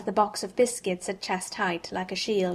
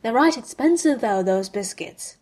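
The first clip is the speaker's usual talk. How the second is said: joking; 2 semitones higher, 5 dB louder than usual. A narrator's reading. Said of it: "he joked".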